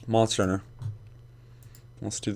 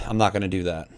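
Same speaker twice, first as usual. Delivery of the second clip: ordinary delivery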